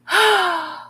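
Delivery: high, loud exhaled sigh. Real, not acted. Streamer caught ignoring the chat, sighing. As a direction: let out a big high sigh.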